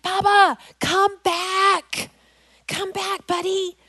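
put-on high, worried mom calling her child back; retold high-pitched call to a child. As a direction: call across the rocks, high and urgent, easing off. Mother calling back straying son.

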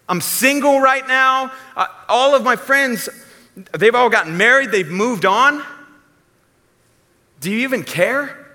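high, loud lament to God about being single; whiny lonely single woman. Pretend. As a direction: whine it up high and loud like a friend who feels forgotten.

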